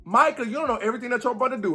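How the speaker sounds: ordinary talk, nothing marked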